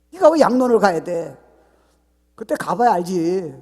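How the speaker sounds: loud, high voice of God scolding; God speaking. Retold or imitated. God rebuking the worried believer, loud and high. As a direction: rebuke them as God would. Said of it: "Why worry about it?".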